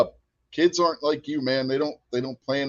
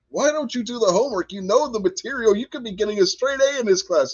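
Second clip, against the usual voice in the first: imitating an exasperated teacher, higher pitched; pleading mock-teacher lecture, pitched up; frustrated teacher; 7 semitones higher than usual. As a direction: plead like a teacher at wit's end, lightly acted. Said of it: "I used to frustrate the hell out of the teacher".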